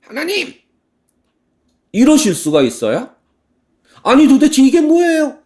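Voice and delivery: high, loud cry of complaint at God; indignant protest. Acted. Prophet Habakkuk protesting to God, high and loud. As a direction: demand answers, outraged. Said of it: "하나님께 따집니다".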